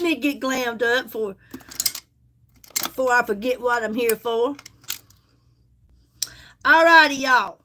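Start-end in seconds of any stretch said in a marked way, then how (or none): none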